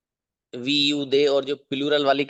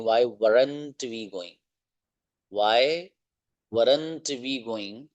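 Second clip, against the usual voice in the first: words stretched out slowly; slow dictation; slow drill; much slower than usual. Shown as a demonstration. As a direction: read it slowly for the class. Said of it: "मिलाकर पढ़ना चाहें".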